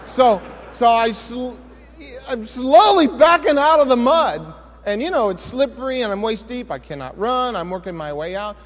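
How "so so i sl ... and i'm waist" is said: tense retelling. Retold or imitated. Preacher telling the church how he backed from the alligator, tense. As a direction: relive creeping away from danger.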